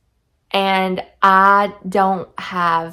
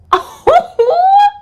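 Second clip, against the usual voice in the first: a delighted shriek of a laugh, much higher and louder; high squealing laugh; 11 dB louder than usual. Real, not acted. Swooning over a romantic line, squealing laugh. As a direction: burst into a giddy squeal of laughter.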